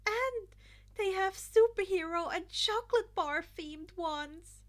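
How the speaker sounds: put-on high, loud excited girl voice; gushing. Mina gushing to the others, high and loud. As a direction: bubble over with enthusiasm.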